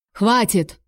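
usual speaking voice

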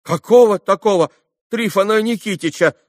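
high, loud, heated bewilderment; foolish surprise. A narrator's reading. Lad staring at Kolya, hotly and stupidly surprised. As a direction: gape in loud, silly amazement. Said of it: "С дурацким удивлением, хотя всё так же горячась".

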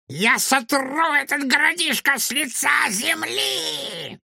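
high, slow, raving villain shout; a maniac announcing destruction; mad scientist. Acted. Villain threatens the city.

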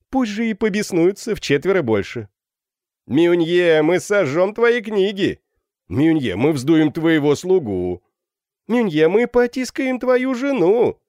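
high, loud taunting chant; rowdy student heckling a victim from above; jeering student. A narrator's reading. (задорная дразнилка школяра)

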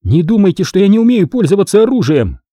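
high, heated warning; defiant, agitated outburst; angry cry. A narrator's reading. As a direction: warn them angrily, pitch riding high. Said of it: "гневно крикнула девушка".

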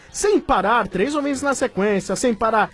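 bright, high announcer read; radio ad read. Acted. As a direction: sell it like a radio spot. Reads a sponsor ad on air.

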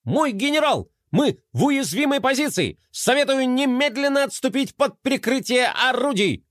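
high, strained robot yelling; straining shout. A narrator's reading. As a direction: shout while hauling a tower. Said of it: "надрывался Мелкотрон".

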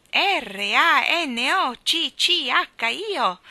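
slow loud drawn-out call; long loud howling call; name drawn out. Acted. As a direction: stretch R A N O C C H I O out loud and slow like the wind. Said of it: "gridavano quel nome".

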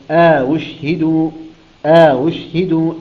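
أشهدوا slow, second hamza eased toward waw; Qur'anic recital. Shown as a demonstration. Teacher demonstrating Qalun's reading, slow tajweed. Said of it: "يقرأها هكذا".

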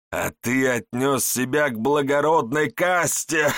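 slow, loud, contemptuous tyrant; villain voice. Acted. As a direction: sneer each word down at a slave. Village elder berates Ichiro.

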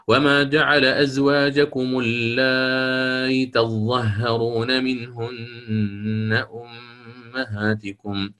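slow chanted tajwid recitation; تلاوة مجوّدة; Quranic recitation. Shown as a demonstration. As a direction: recite it melodically, with eased hamza. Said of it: "فأعيد الأوجه".

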